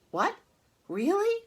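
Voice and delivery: very high, loud hopeful cry; excited pig cry. Acted. Wilbur cries out to Charlotte in sudden hope. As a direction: burst out in startled hope. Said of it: "cried Wilbur".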